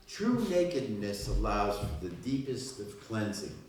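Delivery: measured poetry reading with pauses between phrases; poem recitation. Acted. Poet opens a reading for a cafe audience, measured recitation. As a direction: declaim each line, letting it settle before the next.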